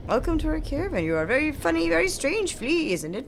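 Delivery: high, grandiose flea showman; Flea Master Mushka. Pretend. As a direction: boom it out like a jolly caravan leader. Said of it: "I am Flea Master Mushka".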